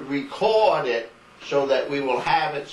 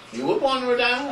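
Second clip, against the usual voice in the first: high, quick disbelieving echo; surprised question; 8 semitones higher, faster than usual. Real, not acted. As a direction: repeat it back in real surprise. Surprised echo of grandma's answer.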